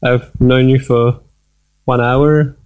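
ordinary talk

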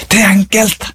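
high-pitched call without extra volume; gentle calling out. A narrator's reading. Trees calling out again to the poet, gentle call. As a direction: call it lightly, well up in pitch. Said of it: "Så roper de igjen".

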